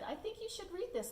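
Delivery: high, innocent offer; sweet suggestion. Acted. Gilbert offering Lewis a book, high innocent offer. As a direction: sound helpful and innocent. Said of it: "Gilbert brought a book to Lewis and said".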